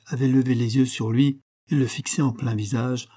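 ordinary delivery

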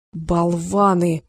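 usual speaking voice

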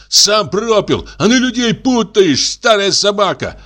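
excited, outraged high-voiced tirade; increasingly agitated shouting. A narrator's reading. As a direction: rant accusations in a screechy high voice. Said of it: "всё более возбуждаясь".

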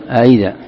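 أإذا repeated with a softened second hamza; no full stop on the second hamza of أإذا; tasheel. Shown as a demonstration. Teacher naming the reading, أإذا with eased second hamza. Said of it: "هذا يسمى، يسمى التسهيل".

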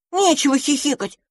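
cross. Acted. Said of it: "рассердился одуванчик".

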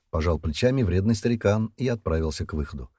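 ordinary delivery

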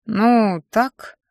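drawn-out, unsure mumble; mumbled hesitantly. A narrator's reading. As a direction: hedge vaguely, stretching the first word. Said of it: "промямлил он".

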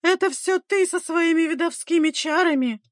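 very high, frantic accusation; grief-crazed cry. A narrator's reading. Grieving mother blaming the midwife, crazed cry. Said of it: "выкрикнула обезумевшая от горя мать".

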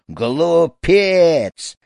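loud, high, drawn-out insult; angry yell. A narrator's reading. Snake charmer berating the poor boy, angry yell. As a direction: cry out in wicked outrage. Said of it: "завопил злой Вайдван".

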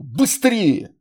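loud urgent bark. A narrator's reading. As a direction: bark to hurry them. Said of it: "рявкнул Май".

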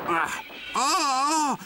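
high, loud cries of pain; wagon crying out. Acted. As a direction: yelp as if jolted hard. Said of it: "křičel vagón". (vysoké hlasité výkřiky bolesti)

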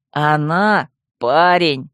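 very slow, sly reveal; sly malice. A narrator's reading. Vemut telling she-wolf the secret, slyly malicious. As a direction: drop each word slowly and pointedly. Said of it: "ехидно склонился к ушку Ваилии".

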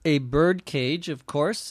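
ordinary talk, nothing marked

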